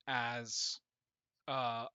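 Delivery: usual speaking voice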